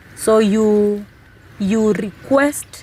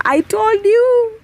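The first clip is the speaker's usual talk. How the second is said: high, drawn-out gloat; acted I-told-you-so; 11 semitones higher, much slower than usual. Wife mimicking herself crowing at her husband, mock gloating. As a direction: stretch it out high, as if rubbing it in.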